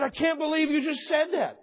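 high, loud disbelief; shocked indignation. Retold or imitated. The stranger shocked at the pastor's reply, indignant. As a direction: gasp out the outrage.

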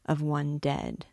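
usual speaking voice

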